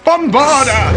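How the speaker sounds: loud, high posh spell cry; posh incantation, pitched high; posh accent. Shown as a demonstration. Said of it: "in a posh accent".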